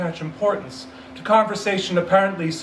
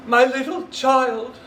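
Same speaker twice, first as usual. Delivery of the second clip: much higher, louder, slow sobbing cry; breaking down; 10 semitones higher, 5 dB louder, slower than usual. Acted. Bob breaking down over his dead son, sobbing cry. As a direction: let the grief burst through. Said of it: "He broke down all at once".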